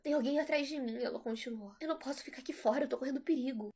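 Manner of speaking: frantic plea. Acted. Mother claims danger, urgent.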